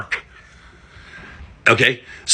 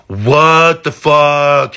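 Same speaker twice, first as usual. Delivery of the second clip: slow, drawn-out what the fuck, louder; stunned disbelief; 4 semitones higher, 6 dB louder, much slower than usual. Real, not acted. Ranter reacting to a third Western candidate, stunned slow disbelief. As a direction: spell it out in shocked disbelief.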